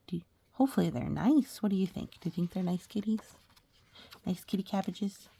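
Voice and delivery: ordinary delivery, nothing marked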